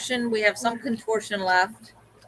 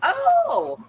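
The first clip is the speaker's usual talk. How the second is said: surprised. Real, not acted. A new guest joins the stream.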